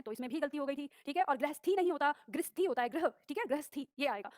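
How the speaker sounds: ordinary talk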